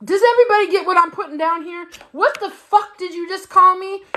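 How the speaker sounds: fiery disbelief; outraged. Real, not acted. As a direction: demand an answer, offended. Outraged at wrong name.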